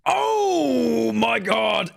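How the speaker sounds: drawn-out, high, triumphant cry; a slow, elated scream of victory; excited shout. Real, not acted. Finds the right pronunciation.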